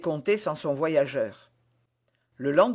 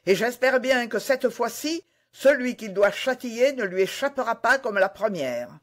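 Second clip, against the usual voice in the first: raised, higher-pitched challenge; high-pitched, defiant declaration; indignant cry; 6 semitones higher than usual. A narrator's reading. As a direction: keep crying out in defiance. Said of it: "s'écria-t-il".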